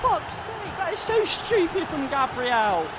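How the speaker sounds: high, strained exasperated yell; furious outburst. Real, not acted. Gamer ranting at his defender, exasperated high outburst. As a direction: vent at the defender's blunder. Furious reaction to conceded penalty.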